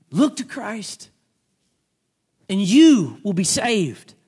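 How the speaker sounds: slow, drawn-out plea; slow appeal. Shown as a demonstration. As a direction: stretch each word as an appeal. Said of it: "repeated slowly as appeal".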